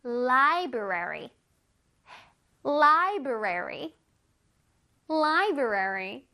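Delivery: library, each syllable drawn out slowly; slow syllable drill. Shown as a demonstration. Teacher modeling library slowly for children. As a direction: stretch out library for the kids. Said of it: "세 번만 해보자".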